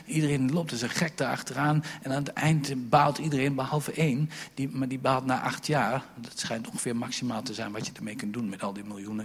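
normal talking voice

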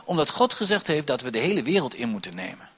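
ordinary delivery

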